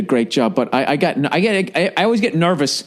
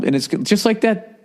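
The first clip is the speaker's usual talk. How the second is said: usual speaking voice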